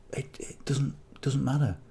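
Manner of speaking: unvoiced whisper, trailing off; whispered aside. Real, not acted. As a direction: drop to a quick whisper as an afterthought.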